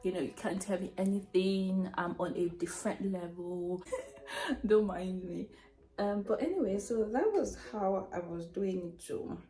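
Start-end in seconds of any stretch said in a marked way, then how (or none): none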